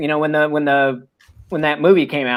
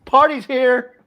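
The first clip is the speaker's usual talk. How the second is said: cocky soldier; 7 semitones higher, 5 dB louder, slower than usual. Retold or imitated. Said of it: "and be like".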